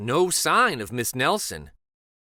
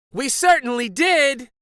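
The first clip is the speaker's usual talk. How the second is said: high, loud eager cry; kids crying out; 13 semitones higher, 7 dB louder than usual. A narrator's reading. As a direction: shout it with relief. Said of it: "cried all the kids".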